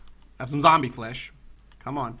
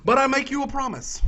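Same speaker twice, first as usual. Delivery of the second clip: ordinary talk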